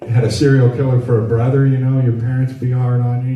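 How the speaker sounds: ordinary delivery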